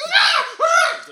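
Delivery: very high, loud laughing shrieks; high laughing shrieks of a greedy creature; startled shrieks. Retold or imitated. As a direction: shriek at the food. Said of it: "he's like".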